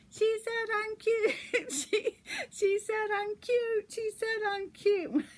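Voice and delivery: very high, drawn-out squeaky glee; giddy Rudolph. Retold or imitated. Rudolph jumping up after Clarice's compliment, giddy. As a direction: squeal it like an overjoyed cartoon reindeer. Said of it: "Rudolph jumps up and he goes".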